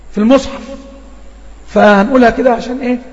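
normal talking voice

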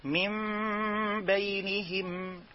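من بينهم slow, n turned to a nasal m; iqlab demo. Shown as a demonstration. Teacher demonstrating iqlab in من بينهم. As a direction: glide the n into m before b. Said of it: "نقول إيه".

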